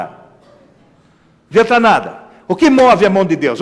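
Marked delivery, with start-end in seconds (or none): 0.0-2.2 "Não adianta nada" drawn out slowly; slow emphasis. Shown as a demonstration. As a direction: spell out the futility. ("Não adianta nada" bem lento)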